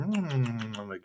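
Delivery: usual speaking voice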